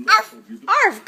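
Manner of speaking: put-on high, loud playful arf for the baby; barking like a dog. Shown as a demonstration. Mom shows her toddler what a doggy says, with a high loud bark. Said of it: "What does a doggy say?".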